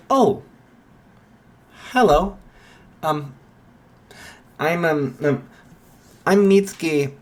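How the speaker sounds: a high, sing-song motherly hello; Mitsuki's bright greeting. Acted.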